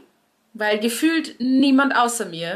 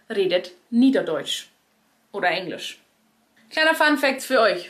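usual speaking voice

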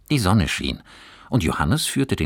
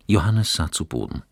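ordinary delivery, nothing marked

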